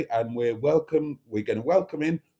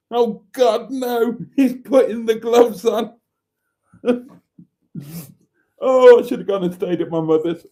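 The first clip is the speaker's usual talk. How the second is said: higher, louder camp cry of dismay; mock horrified wife; 8 semitones higher, 5 dB louder than usual. A pretend wife dreading the gloves, mock horror. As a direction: wail like a fussy wife who wants her mother.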